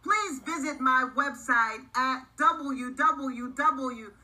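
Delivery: slow, clear outro announcement; announcer read. Acted. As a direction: read it like a podcast sign-off.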